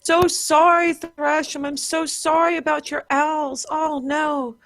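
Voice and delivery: high, slow grieving apology; sorry and heartbroken, pitched high; sorrowful. Acted. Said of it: "you were saying to Thrasham".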